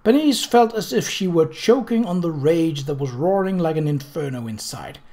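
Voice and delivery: ordinary delivery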